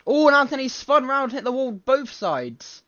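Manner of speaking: sudden high, loud burst of alarm at the spin; high, loud commentator outburst, slowing at the end; excited commentary. Real, not acted. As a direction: shout it out as the car spins and hits the wall.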